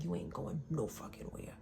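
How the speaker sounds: softly imitated menace. Woman quoting her ex's threat, quietly menacing. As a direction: retell his threat in a hushed voice. Retelling his threat on the train.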